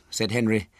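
plain reading voice

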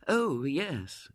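high, drifting lady's reply; vague agreement. A narrator's reading. Mother answering her neighbour, vague. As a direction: agree absently, only half attending. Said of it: "said Missus Brown vaguely".

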